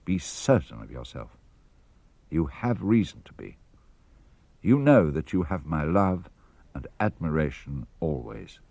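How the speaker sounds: ordinary delivery, nothing marked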